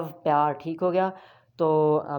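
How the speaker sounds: usual speaking voice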